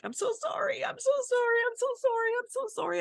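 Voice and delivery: pleading through tears, voice way up; sobbing apology to the wasps while spraying them; tearful apology. Retold or imitated. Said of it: "I'm tears and I'm crying".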